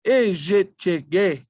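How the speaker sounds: Ijichige said slowly, each syllable apart: i-ji-chi-ge. Shown as a demonstration. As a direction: sound out Ijichige piece by piece, slowly. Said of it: "Then we add our consonants".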